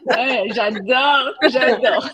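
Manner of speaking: laughing through the words; delighted little laugh on each j'adore. Real, not acted. Host laughing at the Québec accent remark, delighted.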